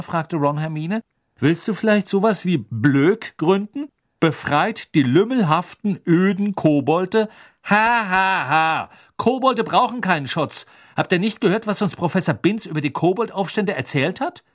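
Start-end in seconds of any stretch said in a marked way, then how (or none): 1.4-8.9 raised, louder mock campaign slogan; sarcastic jeer ending in a dry ha-ha; mocking teasing. A narrator's reading. Ron teases Hermine about goblins. 9.2-14.4 higher-pitched exasperated know-it-all; raised voice, mildly indignant; indignant lecturing. A narrator's reading. Hermine indignantly lectures the boys.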